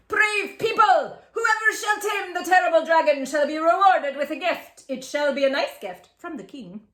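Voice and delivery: loud, high, pompous decree; hammed-up high royal announcement; mock proclamation. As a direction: announce it like villagers copying the king.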